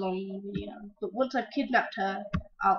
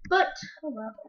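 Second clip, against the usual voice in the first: ordinary talk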